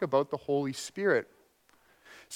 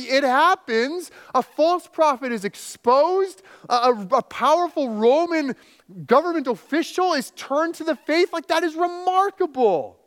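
high, loud, driving sermon voice; impassioned preaching; 10 semitones higher, 5 dB louder than usual. Acted. Preacher marvelling at Paul's bold words to the congregation, loud and high. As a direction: preach it out with full fire.

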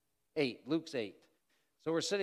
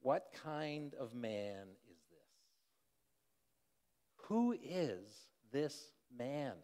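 hushed, slow wondering question; awed pause; 4 dB quieter, much slower than usual. Acted. Stunned disciples asking about Jesus, slow and wondering. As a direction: let each word hang, softly amazed. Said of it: "they basically pause and they say".

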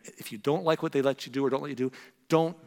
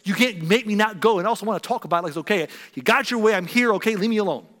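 put-on louder, higher bitter teenage retort; sulky teen snapping; 6 semitones higher, 6 dB louder than usual. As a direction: snap at mom like a resentful teen. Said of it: "I would say".

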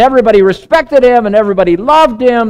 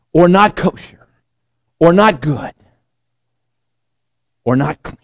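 solemn divine voice; 2 semitones lower than usual. Retold or imitated. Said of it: "The voice says".

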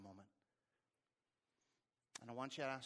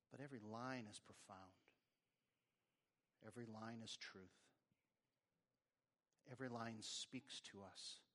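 soft, subdued prayer with long pauses; 2 semitones lower, 5 dB quieter than usual. Real, not acted. Preacher praying for congregation to live free, hushed prayer. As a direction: pray quietly, letting each phrase settle.

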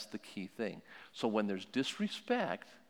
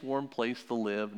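normal talking voice

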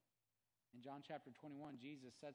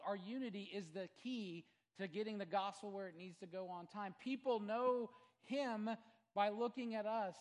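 higher and louder sermon cry; full-force preaching; 6 semitones higher, 10 dB louder than usual. Acted. Preacher exhorts the congregation, full-force preaching.

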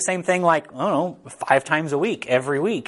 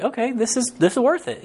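mock kids' voice; 10 semitones higher than usual. Kids won over by board games.

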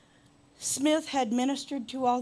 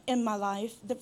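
ordinary talk, nothing marked

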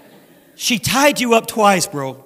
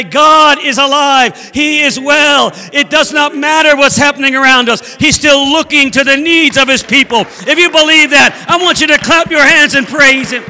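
raised, loud rallying proclamation; full-force preaching; 3 semitones higher, 4 dB louder than usual. Real, not acted. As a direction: rouse the congregation to praise.

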